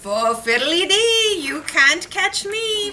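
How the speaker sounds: leprechaun taunt. Acted. Leprechaun's rhyming note read aloud.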